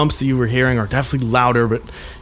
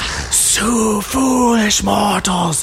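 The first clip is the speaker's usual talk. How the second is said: slow, booming, higher ghostly wraith voice; dark lord; 7 semitones higher, much slower than usual. Acted. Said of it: "And she just goes".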